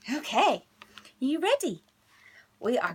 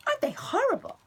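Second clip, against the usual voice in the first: indignant aside; faster than usual. Real, not acted. Teacher asking children, high and appalled.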